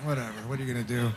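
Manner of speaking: a quick resigned mutter to yourself; resigned inner thought. Retold or imitated. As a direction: mutter it under your breath, quickly. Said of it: "I thought".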